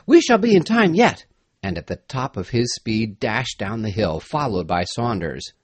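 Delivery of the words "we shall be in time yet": high-pitched cry of urgency; urgent cry. A narrator's reading. Dick urging Saunders to race downhill, urgent cry. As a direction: call it out high to spur him on. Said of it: "he cried".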